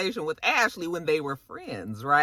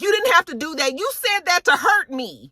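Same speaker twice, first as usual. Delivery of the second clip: high-pitched hurt accusation, acted; mock yelling; 10 semitones higher than usual. Candace accusing Ashley in the car, mock yelling. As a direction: accuse in a raised pitch without a full scream. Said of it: "Candace is screaming and crying and yelling".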